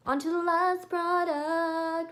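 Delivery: high, loud, drawn-out singsong; mock-grand, high and stretched out; playful announcement. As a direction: announce it slowly in a high silly voice. Playful announcement of last item.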